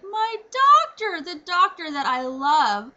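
loud, drawn-out wail of protest; selfish dismay. Retold or imitated. Patient losing the doctor she loves, dismayed protest. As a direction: stretch it out in possessive dismay. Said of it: "selfishly, I was like".

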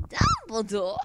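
Dumbledore impression. Fan wondering if it's high, piping Dumbledore. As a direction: pipe the name up high. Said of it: "Or is it high?".

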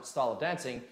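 usual speaking voice

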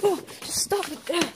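high cries bursting into a shout; panicked pleading. Acted. Pleading with clown to stop, panicked. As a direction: beg the attacker to stop, frantic.

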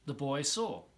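ordinary delivery

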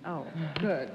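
normal talking voice

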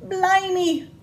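ordinary delivery, nothing marked

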